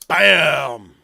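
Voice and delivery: a sudden loud shout; shouted bam. Real, not acted. Ranting at tyrants to his viewers, sudden loud shout. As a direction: slam the word out like a punch. Threatening tyrants, mock blow.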